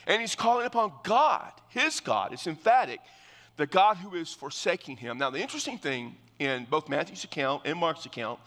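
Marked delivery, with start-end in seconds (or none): none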